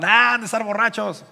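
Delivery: loud, high jeer; mocking. Onlookers make fun of the disciples, mocking. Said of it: "Otros se burlaban".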